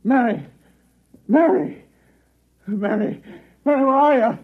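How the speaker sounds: high, loud panicked calls; frantic calling. Acted. Husband wakes screaming, calls frantically for his wife. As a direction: shout for her in terror.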